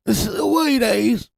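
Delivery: British accent, called out high; shouted British. Shown as a demonstration. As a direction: shout sweeties like a Londoner. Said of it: "I got British".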